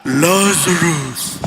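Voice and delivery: low, slow, booming name call; slow deep heavenly summons; archangel's call. Shown as a demonstration. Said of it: "In the heavenlies, parang".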